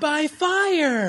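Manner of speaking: high hammed-up cry; dramatic echo. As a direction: shout it like a villain. Hosts echo the rhyme dramatically.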